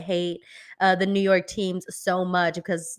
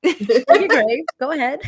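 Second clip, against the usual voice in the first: laughing through the words; laughing repeat; 5 semitones higher, 5 dB louder than usual. Retold or imitated. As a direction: giggle while repeating it. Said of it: "he's like".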